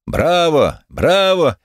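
high-pitched, drawn bravos without real shouting; enthusiastic cheers. A narrator's reading. Crowd sides with the defender of faith, cheering. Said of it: "с энтузиазмом принявших сторону".

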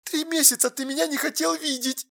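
very soft, high, hurt voice; hushed, wounded woman's voice; tearful reproach. A narrator's reading. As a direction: accuse him quietly, close to tears. Tearful girlfriend reproaching him.

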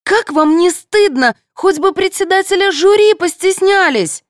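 high-pitched indignant scolding; outraged telling-off, voice right up; losing her temper. A narrator's reading. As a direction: shame them in a high, exasperated voice. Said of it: "вышла из себя Анюта".

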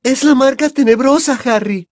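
high, loud, anguished wail; frightened moan. A narrator's reading. Hermione tugging Harry away with all her strength, moaning in fear. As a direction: moan it in dread. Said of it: "gimió Hermione".

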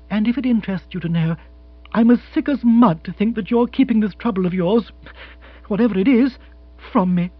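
lightly voiced woman lecturing her partner; earnest, mildly indignant scolding; reproachful fiancée. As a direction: press home a lovers' reproach. Narrator voices Jill scolding.

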